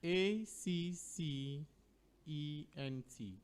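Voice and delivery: slow, drawn-out letter-by-letter spelling. Shown as a demonstration. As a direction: spell it slowly for the listeners. Spelling out the quiz word.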